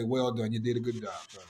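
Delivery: low, hushed fatherly praise; very quiet, heartfelt commendation; tender approval. Retold or imitated. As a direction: drop right down, soft and low, as if blessing a son. Imagines God's approval of him.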